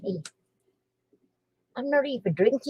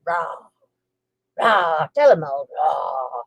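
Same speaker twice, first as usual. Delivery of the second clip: low playful growl, pretend fierce dog; mock dog growl; 7 semitones lower, slower than usual.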